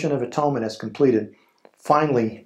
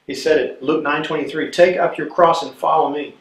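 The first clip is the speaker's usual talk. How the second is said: rally the congregation to take up their cross; fervent preaching; 3 semitones higher than usual. Acted. Preacher rises to exhortation.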